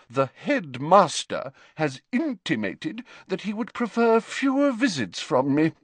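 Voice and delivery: the snubbed teacher turns icy; cold. A narrator's reading. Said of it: "she said coldly".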